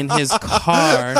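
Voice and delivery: laughing through the words, high and drawn out; slow, high-pitched helpless laughter. Real, not acted. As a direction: crack up while finishing the sentence. Cracking up at own note.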